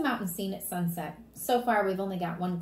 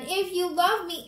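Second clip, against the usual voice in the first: high, slow, louder wheedling; pleading child; 10 semitones higher, 6 dB louder than usual. Acted. Fudge working on Mom's love, pleading. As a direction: wheedle your mother like a little kid.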